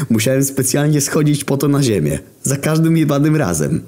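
wyższy, lekko jękliwy głos Jezusa; Jezus. A narrator's reading. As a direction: zagraj beztroskiego Jezusa, wyżej. Jezus quoted in story. (Jezus narzeka, głos wyraźnie wyższy)

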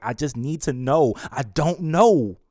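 desperate, stretched-out outburst; desperate uncertainty. Acted. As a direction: plead loudly, not knowing. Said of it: "so much uncertainty in that word".

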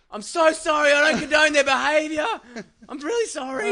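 mock apology. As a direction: grovel to the stranger on the road. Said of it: "I'm like".

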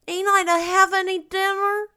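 put-on high, loud childish wail; wailing boy. A narrator's reading. Davy asks Marilla about dinner, high wailing boy. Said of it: "wailed Davy".

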